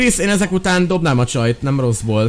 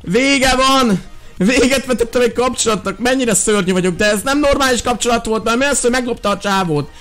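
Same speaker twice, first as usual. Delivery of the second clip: excited outburst; 6 semitones higher than usual. Real, not acted. Dramatic after breakup in game.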